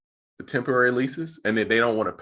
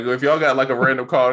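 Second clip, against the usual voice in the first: usual speaking voice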